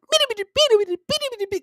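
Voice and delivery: very high-pitched yapping babble; mock ranting gibberish. Host mimics the DJ's yelling as gibberish, high mocking yap. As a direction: imitate an angry man as squeaky nonsense chatter. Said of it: "he's up to, like".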